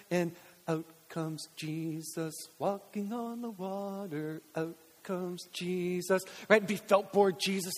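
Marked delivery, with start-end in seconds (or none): 0.7-6.2 slow, hushed storytelling voice; Sunday school teacher. Retold or imitated. Dear teacher showing felt board to kids, slow soft storytelling. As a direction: draw it out softly like a felt-board reveal. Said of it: "this dear person".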